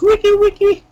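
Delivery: very high, loud record-scratch imitation; DJ scratch noise. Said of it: "and it goes".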